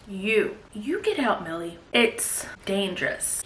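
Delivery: slow, hushed, halting warning; grave warning. Acted. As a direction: warn her softly, leaving space between words. Enzo's grave warning at the door.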